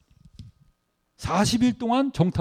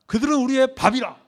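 louder and higher, triumphant; bold declaration; 5 semitones higher, 6 dB louder than usual. Acted. Caleb boldly assures the people, triumphant and loud. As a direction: proclaim it with full confidence.

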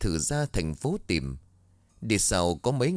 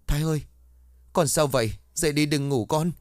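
high, louder, frightened pleading; stammering in fear; 5 semitones higher, 3 dB louder than usual. A narrator's reading. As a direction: shake him awake with your voice, scared. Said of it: "bà ấp úng". (ấp úng sợ hãi, giọng cao và to hơn)

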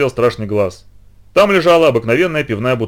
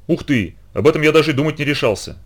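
ordinary delivery

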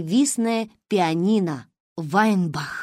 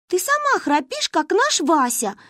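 defiant retort; 6 semitones higher than usual. A narrator's reading. Manka fights back at the older sister, a cheeky, higher retort. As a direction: fire back at your sister. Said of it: "пошла в бой Манька".

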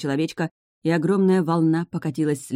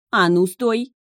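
sharp command; 5 semitones higher, 4 dB louder, slower than usual. A narrator's reading. Heroine grabs fleeing witch.

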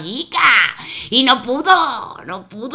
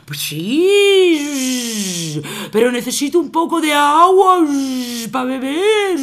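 louder, odd tree voice; tree asking for water; 5 semitones higher, 5 dB louder, slower than usual. Acted. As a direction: speak as the tree. Tree answers.

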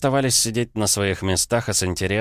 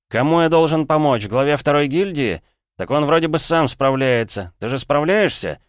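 higher-pitched mocking questions; irritated sarcastic friend; 8 semitones higher, 5 dB louder than usual. Narrator brushes off the minister's plea, sarcastic and irritated. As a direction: needle them with raised-voice questions.

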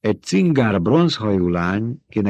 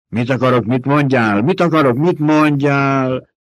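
higher, louder sneering parrot; cruel mocking mimicry; 6 semitones higher, 5 dB louder than usual. Old woman cruelly mimicking young Jessica's question. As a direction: ape her words with contempt. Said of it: "kegyetlen gúnnyal majmolta".

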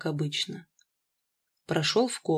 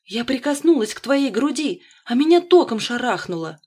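high, loud agitated outburst; worked-up, startled exclaiming; alarmed protest; 7 semitones higher, 9 dB louder than usual. A narrator's reading. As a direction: protest loudly in shock. Shocked woman protests after electric jolt.

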